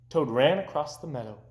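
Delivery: plain reading voice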